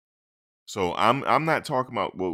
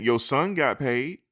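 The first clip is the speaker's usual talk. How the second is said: raised, higher demand; mock demand; 7 semitones higher, 5 dB louder than usual. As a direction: push back loudly. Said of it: "these players are saying".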